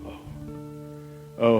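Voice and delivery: ordinary delivery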